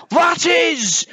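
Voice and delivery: high, emphatic yell; big bear's excited shout. A narrator's reading. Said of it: "shouted the big one".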